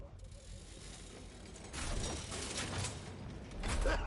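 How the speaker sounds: long, breathy cackle; soft wheezing laughter that swells. Acted. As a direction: dissolve into a drawn-out laugh. Laughs after the mock begging.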